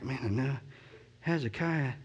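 a caught student fumbling for an answer; unsure mumble. Retold or imitated. Drawing a blank, embarrassed.

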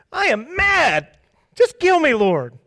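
high, loud, slow whiny tantrum; childish Jonah. Pretend. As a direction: whine like a sulking child. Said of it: "Jonah is like a child".

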